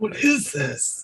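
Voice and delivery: high, soft, drawn-out puzzlement; mock bafflement. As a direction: imitate someone baffled, slow and high-pitched. Mimicking a baffled reaction to boxcar.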